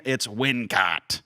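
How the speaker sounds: usual speaking voice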